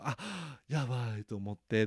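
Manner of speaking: normal talking voice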